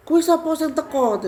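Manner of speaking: high, loud angry demand of a possessing spirit; marah. Retold or imitated. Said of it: "marah, terus dia tuh bilang begini". (suara marah, tinggi dan keras)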